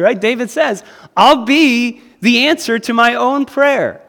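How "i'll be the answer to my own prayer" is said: bold declaration. Acted. Preacher voicing David's bold claim.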